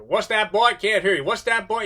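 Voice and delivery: loud, high jeering call across a rowdy room; mocking heckle. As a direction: bark it back at him, taunting, pretending not to hear. Drunk men heckling the speaker.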